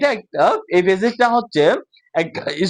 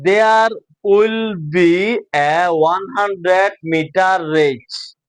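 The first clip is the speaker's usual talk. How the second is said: very slow, separated reading; measured classroom model reading; word by word; much slower than usual. Shown as a demonstration. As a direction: read each word on its own for learners. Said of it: "আলাদা আলাদা করে".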